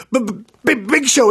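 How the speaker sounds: high, stuttering fan impression; mimicking an overwhelmed cop; starstruck stammer. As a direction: trip over the name in awe. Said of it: "and he's shaking. He goes".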